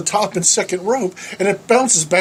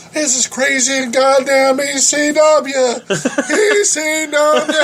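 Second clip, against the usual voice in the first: high-pitched, hammed-up holler; mock yell, drawing out the last letters; imitated wrestler's yell; 10 semitones higher, 4 dB louder, slower than usual. Wrestler yells at the teller after the bump, high mock holler. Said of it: "He's yelling at me".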